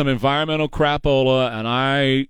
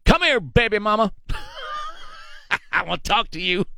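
higher, swaggering mock voice; sleazy come here, hammed up; 8 semitones higher than usual. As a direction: councilman cornering colleague, mock swagger. Imitating Bravo in hallway.

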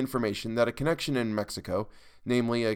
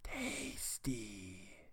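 soft murmur; 17 dB quieter than usual. A narrator's reading. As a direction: murmur it hungrily, barely audible. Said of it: "over to murmur".

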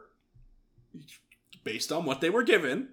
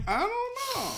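much higher, clueless squeak; high squeaky voice. Retold or imitated. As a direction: squeak it out helplessly. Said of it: "I'm like".

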